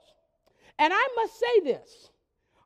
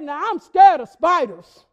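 put-on teen's confession; 9 dB louder than usual. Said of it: "She said".

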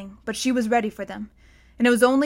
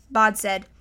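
ordinary delivery, nothing marked

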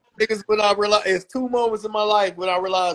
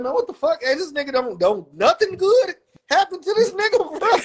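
imitating himself watching a movie; 7 semitones higher than usual. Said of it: "I was like".

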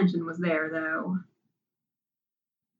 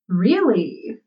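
louder mock surprise, pitch swooping up and down; overly surprised; 2 semitones higher, 5 dB louder than usual. A narrator's reading. Crowley making fun of Zira about her answer, overly surprised. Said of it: "in an overly surprised voice".

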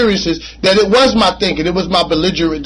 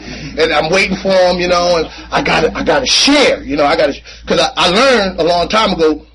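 usual speaking voice

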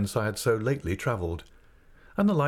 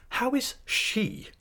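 very high, slow, emphatic question; aunt's high, drawn-out demand; insistent aunt; much slower than usual. A narrator's reading. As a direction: insist on an answer, each word stretched. Aunt insists on news of baby.

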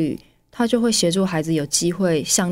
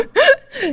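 very high, loud burst of laughter; 5 dB louder than usual. Real, not acted. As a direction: laugh out at the joke. Listener laughs at mother's joke. (高声大笑)

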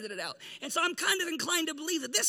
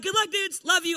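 breezy send-off; 5 semitones higher, 6 dB louder than usual. Retold or imitated. Jesus breezily leaving disciples.

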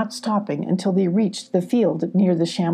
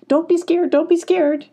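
much higher, bright sing-song; cheerful Clarissa; 10 semitones higher, 3 dB louder than usual. A narrator's reading. Clarissa greeting the startled foxes, much higher. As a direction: surprise them kindly.

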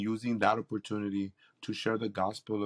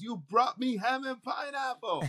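high-pitched, delighted exclamation; amazed delight; 10 semitones higher than usual. Retold or imitated. Homeless man opening the case of food, high amazed delight. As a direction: imitate his giddy disbelief at the food. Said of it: "he goes".

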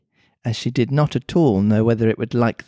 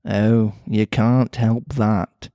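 put-on Mancunian accent; 3 semitones lower, much slower than usual. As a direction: lower Mancunian cat voice. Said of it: "pretty Mancunian sounding accent".

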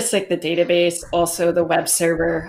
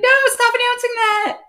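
squealing protest. Retold or imitated. Panicked retold protest.

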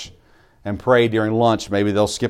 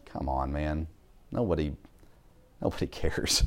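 much softer muttering under the breath; muttered aside; 7 semitones lower, 14 dB quieter than usual. Retold or imitated. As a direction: say it to yourself, barely voiced. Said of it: "I was just sitting there going".

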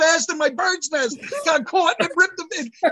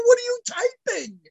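much higher, furious mock tirade; retold angry outburst; 8 semitones higher than usual. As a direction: rant at the lab like you're fed up. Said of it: "I go".

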